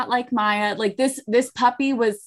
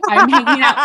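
laughing; 5 semitones higher, 4 dB louder, faster than usual. Real, not acted. Laughing while telling story.

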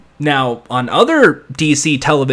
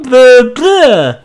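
loud, high "blah, blah"; mocking blah; 8 semitones higher, 6 dB louder than usual. Summing up the boring scene, mocking blah. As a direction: brush off the dialogue. Mock dismissal of dialogue.

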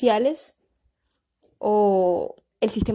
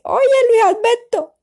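loud, high Cartagena call, "Alberto" with the R swallowed; Cartagena accent; 8 dB louder than usual. Shown as a demonstration. Demonstrating how cartageneros call Luis Alberto, exaggerated Cartagena accent. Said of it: "el cartagenero tiende a cambiar".

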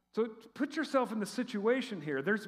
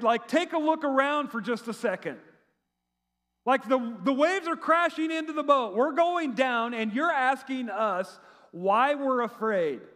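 acted incredulous protest, pitched up; higher, exasperated sarcasm; sarcastic; 4 semitones higher than usual. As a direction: challenge him with mock disbelief. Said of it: "a little bit of sarcasm".

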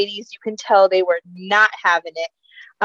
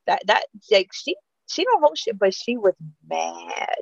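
normal talking voice

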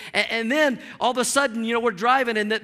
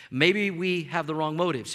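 ordinary talk, nothing marked

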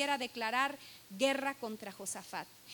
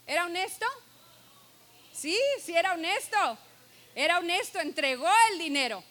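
louder, higher preaching call; preaching at full force; 4 semitones higher, 7 dB louder, faster than usual. Real, not acted. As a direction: build the answer up to the crowd. Preacher asks and answers aloud.

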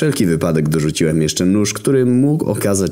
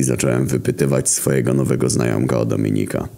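ordinary delivery, nothing marked

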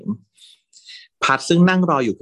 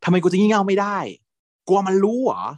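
put-on higher, louder drunken challenge; loud retort; 10 semitones higher than usual. Drunk boyfriend shouting back at his partner, higher and louder. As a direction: throw it back loudly, taunting. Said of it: "ตอบมาแบบเสียงดังเลยนะ".

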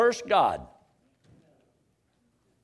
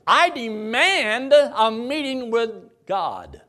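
demanding; 6 semitones higher, 5 dB louder, slower than usual. Pretend. Job demanding to meet God.

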